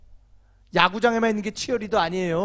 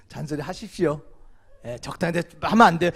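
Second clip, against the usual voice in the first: ordinary talk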